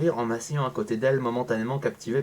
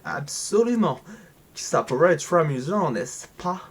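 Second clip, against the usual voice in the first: put-on slow, high English-accented French; 3 semitones higher, slower than usual. Reading the game line in a mock English accent. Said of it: "Pourquoi j'ai pris un accent anglais".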